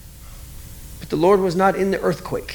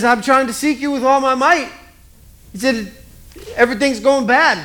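higher, self-pitying whine; whiny complaint; 6 semitones higher than usual. Acted. Elijah in cave complaining to the Lord, higher self-pitying whine. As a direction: complain like everyone has wronged you. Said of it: "He says".

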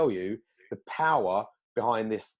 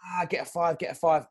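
higher, brushing off the warning; dismissive insisting; 6 semitones higher than usual. Retold or imitated. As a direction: wave it away, get a five. Mate insisting on bigger kite.

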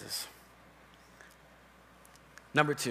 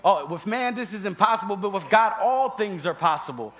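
raised, emphatic scripture quote; forceful, higher-pitched proclamation; louder than the devil; 4 semitones higher, 6 dB louder than usual. Shown as a demonstration. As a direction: declare it loudly over the devil. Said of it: "if you're not louder than the devil".

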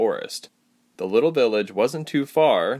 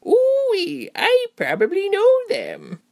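very high, slow, delighted exclamation; high-pitched, eager innkeeper; excited old lady; 4 dB louder, slower than usual. A narrator's reading. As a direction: gush as a thrilled little landlady. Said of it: "the lady answered excitedly".

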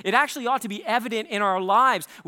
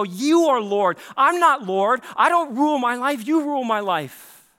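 higher and louder, proclaiming lordship; fervent declaration; 5 semitones higher, 7 dB louder than usual. Pretend. Believer declaring to God at the start of the day, loud and fervent. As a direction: cry it out as a vow of surrender.

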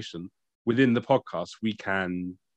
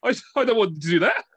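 laughing protest; 11 semitones higher, 3 dB louder than usual. Real, not acted. Protesting about his free time.

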